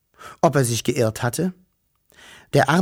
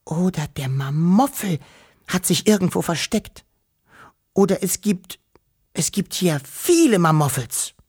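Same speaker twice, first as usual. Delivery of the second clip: higher, frightened stammering; trembling with fear; 5 semitones higher than usual. A narrator's reading. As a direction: panic creeping in, pitch climbing. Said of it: "heftig zu zittern".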